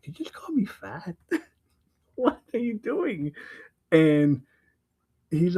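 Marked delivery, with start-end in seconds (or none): 0.0-3.3 higher, lightly acted indignation; mock offended. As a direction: imitate an affronted cat, amused. Retelling cat's offence at fat remark.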